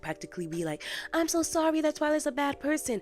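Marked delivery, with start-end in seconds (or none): 1.1-3.0 high-pitched gushing apology; high, put-on sympathy; mock consoling. Acted consoling of crying Cadance.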